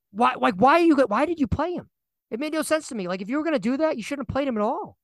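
high-pitched, exasperated questioning. Real, not acted. Fan questioning the coach's quarterback decision, exasperated. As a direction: protest in real disbelief, pitch way up.

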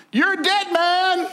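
high, slow, ominous voice of God; God's warning. Retold or imitated. God warns the king in a dream, ominous. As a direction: pronounce doom on the king. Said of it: "God came to Abimelech in a dream".

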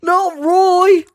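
loud, high cry for a fallen friend; anguished shout. Pretend. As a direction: scream the name in despair. Soldier crying over fallen comrade.

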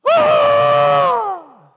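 high, loud panicked yell; panicked shout. Retold or imitated. Servant panics and yells to wake Elisha, shrieking. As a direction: scream him awake in a panic.

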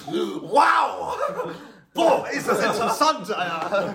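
high, hammed-up amazement with laughter; gespielte Begeisterung. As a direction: act wildly fascinated, as if on drugs. (übertrieben begeistert, lachend)

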